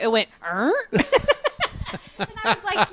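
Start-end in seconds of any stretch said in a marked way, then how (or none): 0.4-0.7 short imitated fart that rises like a question; questioning fart noise. Parent retelling son's fart, questioning noise. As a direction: make a little fart sound that asks something. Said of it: "That sounded like a question".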